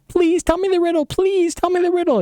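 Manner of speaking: high, fast whiny pleading; nagging wife. Acted. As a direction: wheedle it over and over like a pestering wife. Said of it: "his wife starts nagging him".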